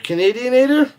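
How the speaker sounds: incredulous repeat; puzzled question. Shown as a demonstration. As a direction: toss Canadianator up high in disbelief. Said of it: "I can't even say it".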